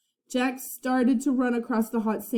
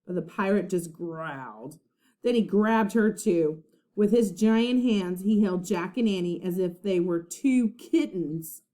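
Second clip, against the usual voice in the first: usual speaking voice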